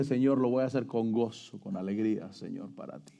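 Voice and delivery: a quiet, surrendered vow to the Lord; humble prayer. Acted. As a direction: pray it humbly with a soft, low voice.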